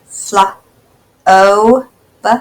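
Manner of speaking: Fla, o, b said as separate parts; segmented sounds. Shown as a demonstration. Teacher breaking flobe into parts. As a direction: sound out each part of flobe. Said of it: "let's say each part".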